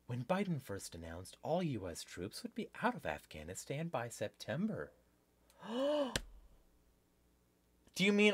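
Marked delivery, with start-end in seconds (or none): 0.1-4.9 low, very soft reading voice; article read-aloud. Real, not acted. Host reads news article to viewers, quiet read-aloud. 5.7-6.2 high, soft gasp of feigned shock; mock gasp. Host reacting to troop withdrawal news, mock shocked gasp.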